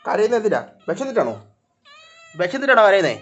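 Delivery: high, loud questions to a crying cat; pet talk. Real, not acted. (പൂച്ചയോട് ഉയർന്ന സ്വരത്തിൽ ചോദിക്കുന്നു)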